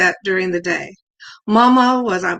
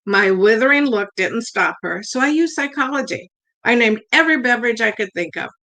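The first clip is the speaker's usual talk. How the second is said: ordinary delivery, nothing marked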